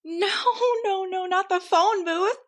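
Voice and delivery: playful high-pitched protest; mock dismay. Real, not acted. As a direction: beg the story not to go there. Reacting to tornado in story.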